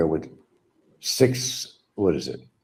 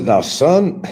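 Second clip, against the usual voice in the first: Foghorn Leghorn; 9 semitones higher, 7 dB louder than usual. Retold or imitated. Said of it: "that's the way the rooster talked".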